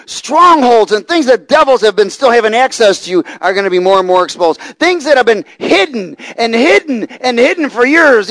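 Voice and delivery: loud, high fervent preaching; full-force preaching. Acted. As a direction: preach it out with fire.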